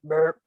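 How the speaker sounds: low, playful burp word; playful low burp for kids; mock burp. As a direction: say burp like the doll burping. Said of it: "until she burped".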